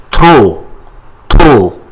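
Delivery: trop said loudly with no P; silent P. Shown as a demonstration. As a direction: end "trop" on the O sound, P silent. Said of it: "We don't pronounce P".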